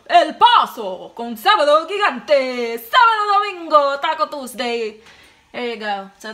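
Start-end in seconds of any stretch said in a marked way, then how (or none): 0.1-1.1 loud slow call. Pretend. Repeating the city name louder. 1.1-5.0 Sábado Gigante announcer yell; hammed-up TV announcer. TV show jingle chant.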